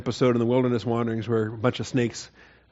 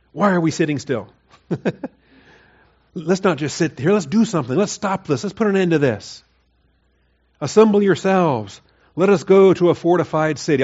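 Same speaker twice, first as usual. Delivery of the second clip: higher, louder, a mocking call to action; defiant rallying cry; 5 semitones higher, 4 dB louder than usual. Preacher mimicking Judah rallying the people, defiant and loud. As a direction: whip up the crowd, all bluster. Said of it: "their response to the "I will" message".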